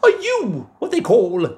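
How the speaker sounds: high, loud curious giantess. Acted. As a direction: peer down at something tiny. Wife questions tiny Jack.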